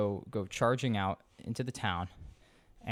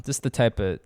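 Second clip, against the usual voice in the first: usual speaking voice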